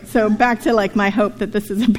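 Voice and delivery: usual speaking voice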